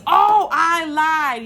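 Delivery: loud, slow, drawn-out oh I lied; sudden realization. Real, not acted. Vlogger suddenly remembering an artist, loud and surprised. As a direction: catch yourself mid-sentence.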